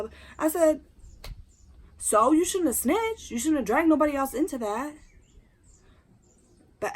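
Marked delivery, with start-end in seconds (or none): 2.0-4.9 scolding. Retold or imitated. Scolding the snitch.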